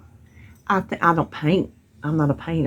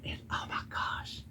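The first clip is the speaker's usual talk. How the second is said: breathy whispered oh my gosh; whispered gush; 18 dB quieter than usual. Real, not acted. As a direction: drop to a whisper, almost to yourself. Gushing aside about the pedestal.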